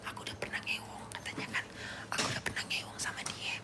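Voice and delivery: hushed gossip whisper; voiceless secretive whisper. Acted. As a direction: whisper it like a secret being spilled. Acts out gossip being spread.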